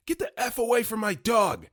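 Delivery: high, loud imitated shout; angry yell. Tommy Lee Jones yelling at the young guard to leave his dog. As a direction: bark it like a furious old man. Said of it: "He points and yells".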